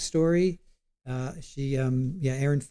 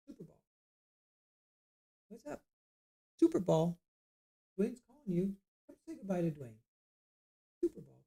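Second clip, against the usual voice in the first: softer, sing-song calls to a pet, mostly under the breath; coaxing the cat; 3 semitones higher, 7 dB quieter than usual. Real, not acted. Calling his cat to say goodbye to a viewer, soft coaxing voice. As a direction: gently coax the cat over to the camera.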